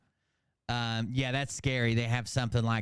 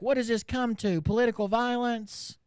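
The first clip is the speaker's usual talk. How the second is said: mock outraged streamer; 8 semitones higher than usual. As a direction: ham up her fake horror, pitched way up. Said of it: "And then she's like".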